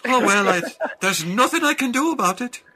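high, helpless Irish lilt; Irish pushover. Acted. Irish mascot giving in to the children, meek Irish accent. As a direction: give up meekly in high-pitched Irish. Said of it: "the Irish are pushovers".

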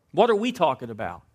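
ordinary delivery

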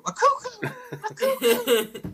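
put-on very high excited little-kid squeal; excited toddler. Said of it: "he calls it cuckoo".

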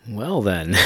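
ordinary talk, nothing marked

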